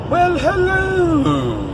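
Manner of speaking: long, high, sung-out greeting; drawn-out sing-song hello. Real, not acted.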